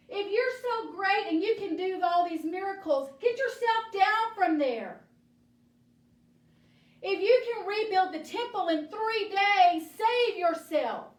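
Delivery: loud, high taunting yell; jeering shout. Acted. Crowd jeering at Jesus on cross.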